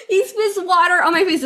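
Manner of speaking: real laughter in the voice; laughing. Real, not acted. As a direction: still giggling as she says it. Retelling, still laughing.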